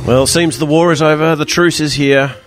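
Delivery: ordinary talk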